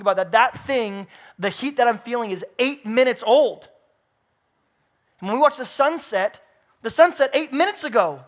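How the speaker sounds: loud, rising sermon delivery, pressing every line; preaching at full force. Acted. Preach it out to the back row with mounting fire. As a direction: pastor builds the crowd's wonder at creation, preaching hard.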